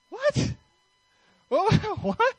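very high-pitched, hammed-up "what?"; mock incredulous. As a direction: squeak it out in mock disbelief. Retells own stunned reaction to friend.

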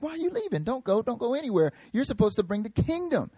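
high, pleading voice of worried followers; pleading disciples. Retold or imitated. Disciples beg Jesus to stay.

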